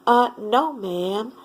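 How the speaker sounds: ordinary delivery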